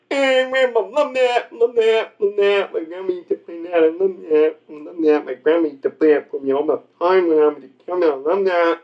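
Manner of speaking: mumbled, repetitive mock simpleton voice; slow-person impression. Comic imitating someone loving an old cartoon, slurred babble. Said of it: "You got that down way too well".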